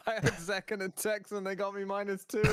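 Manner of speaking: words broken by laughter; chuckling at own fantasy loss; laughing while telling. Real, not acted. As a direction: laugh through the bad news.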